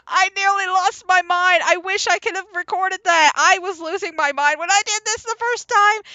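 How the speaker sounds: high, loud bursts of laughter; real giddy laughing. Real, not acted. Recalling losing their mind at the boss, giddy laughing. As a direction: talk high through leftover laughter.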